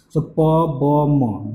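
"pa, ba, ma" with both lips pressed; bilabial demo. Shown as a demonstration. Teacher modelling bilabial sounds for students. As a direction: pop "pa, ba, ma" off closed lips. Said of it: "These three sounds".

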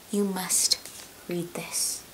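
an intense whisper of insistence; hushed insistence; whispered urging. Acted. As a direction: lean in and whisper the order. Urging fans to read.

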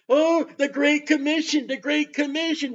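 high-pitched mocking churchgoer quote; mock enthusiasm. Mocking what the church says.

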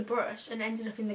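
picking at teeth while talking; words muffled by fingers; finger in mouth. Real, not acted. Seen 4: Fingers touch front teeth picking bristle from 2.5 to 3.3.

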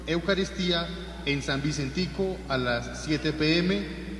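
ordinary delivery

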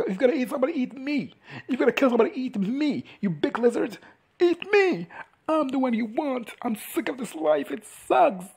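high-pitched, worked-up mock yell; desperate goading. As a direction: act out a fed-up dare to a huge beast. Voicing a man goading the dragon.

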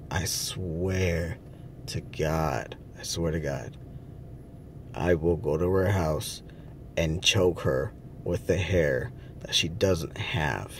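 low seething threat. Real, not acted. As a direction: grind out the threat under your breath. Angry threat after insurance news.

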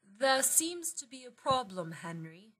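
put-on English accent, high start falling away; fake English accent. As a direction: play a grand lady faking an English accent. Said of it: "in a fake English accent".

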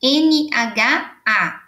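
slow, careful letter-by-letter spelling. Shown as a demonstration. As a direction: spell each letter slowly. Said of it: "todas que terminarem com nha".

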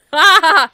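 sudden high laugh bursting out; loud burst of laughter. Real, not acted. Big laugh after cold slip.

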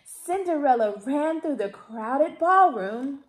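usual speaking voice

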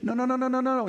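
high, rising panicked whoas and nos; mock panic, voice shooting up; retold panic. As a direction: replay the moment of panic, pitch climbing. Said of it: "I'm just panicking".

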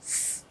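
voiceless sustained hiss on "S"; isolated S sound. Shown as a demonstration. Teacher giving the odd consonant in conserve, hissed S. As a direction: say "S" as a soft sss, not the letter name. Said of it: "Đó chính là âm".

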